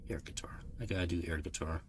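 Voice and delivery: hushed whisper to self. Real, not acted. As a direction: whisper it, amused. Whispers while playing.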